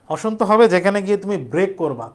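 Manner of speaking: ordinary talk, nothing marked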